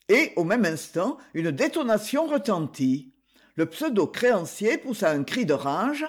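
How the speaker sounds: ordinary delivery, nothing marked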